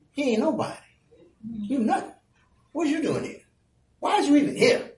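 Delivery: high, soft belittling of a younger brother; diminishing older brother. Acted. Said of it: "he's diminishing him".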